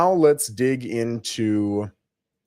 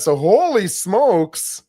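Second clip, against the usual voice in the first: surprised; 6 semitones higher, 6 dB louder, slower than usual. Real, not acted. Shocked at the high price.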